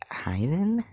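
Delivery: high, jittery nervous hello. Acted. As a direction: squeak it out nervously. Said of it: "in a nervous voice".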